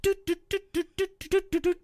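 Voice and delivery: high doot-doot sound effect; mock running noise. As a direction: bounce out cartoon footsteps. Imitated footsteps running to ship.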